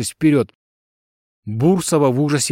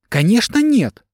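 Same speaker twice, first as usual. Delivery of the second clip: sharply higher, indignant denial; indignant flare-up; 10 semitones higher than usual. A narrator's reading. Accused wife rebutting the investigator, indignant. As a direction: flare up, pitch leaping. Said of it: "вскинулась Бурсова".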